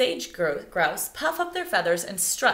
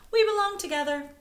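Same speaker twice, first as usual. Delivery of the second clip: much higher, sing-song; high, tender bird voice; sweet bird voice; 12 semitones higher than usual. Acted. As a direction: sing it to your mate. Female bird speaks.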